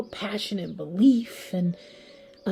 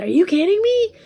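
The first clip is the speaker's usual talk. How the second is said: high, loud indignant outburst; outraged disbelief; 12 semitones higher, 11 dB louder than usual. Acted. Collective answering a partner's apology, outraged and incredulous. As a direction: explode in mock-outraged disbelief.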